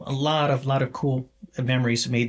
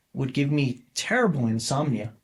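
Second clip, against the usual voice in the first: normal talking voice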